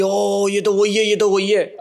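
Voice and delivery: half-acted fan shriek, "it's him"; imitating excited girl. Girl at the biryani shop spotting him, mock excited squeal. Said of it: "चीख रही है".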